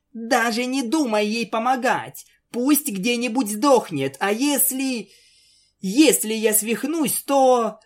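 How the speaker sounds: high anxious plea; high pleading pony voice. Acted. Frightened friend pleads. (высокий умоляющий голос)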